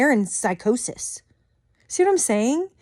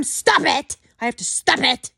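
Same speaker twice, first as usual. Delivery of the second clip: mocking stop it; 3 semitones higher than usual.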